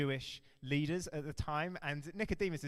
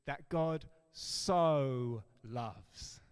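"so" stretched long and louder in "that God so loves"; emphatic so; 5 dB louder, much slower than usual. Shown as a demonstration. Preacher showing the verse's intensity, slow emphatic stress on "so". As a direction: draw out "so" slowly with weight. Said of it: "There's an emphatic nature to this".

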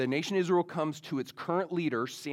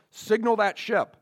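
louder, higher commanding voice; captain's order; 7 semitones higher, 7 dB louder than usual. Retold or imitated. Captain ordering the lookout to signal a ship, commanding. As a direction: give a firm order to a crewman.

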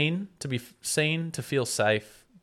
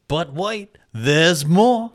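1 infomercial announcer; 8 semitones higher, 11 dB louder, much slower than usual. Pretend. Infomercial catchphrase.

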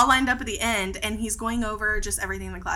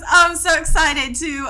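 lightly Australian, louder and laughing; hint of Australian; 4 semitones higher, 6 dB louder than usual. Imitating the instructor's greeting at the salon, faintly Australian. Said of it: "why do I sound Australian".

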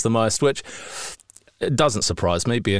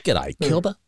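put-on Australian accent; 4 semitones higher than usual. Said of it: "I'm sure you can guess".

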